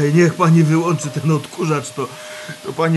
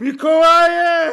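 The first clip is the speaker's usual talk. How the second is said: high, slow dream cry; calling out; 11 semitones higher, much slower than usual. Acted. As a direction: cry out half asleep.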